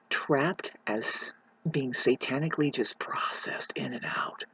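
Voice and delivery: slow, unvoiced whisper. Real, not acted. Vlogger whispers about souls processed in and out. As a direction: whisper it slowly.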